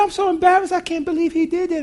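a hint of mortified apology; embarrassed daughter. Daughter telling grandpa she is embarrassed, lightly voiced.

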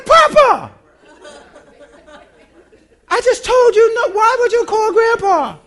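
high, loud scolding through laughter; laughing scold. Retold or imitated. Mother scolding her son while laughing, high and loud. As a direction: scold him while cracking up.

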